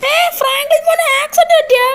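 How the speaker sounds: put-on high, loud old granny gasp of shock; granny catching the news, alarmed. Granny hears of the accident. (ഞെട്ടലോടെ മുത്തശ്ശിസ്വരം)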